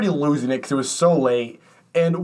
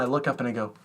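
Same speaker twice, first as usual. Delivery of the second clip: ordinary talk, nothing marked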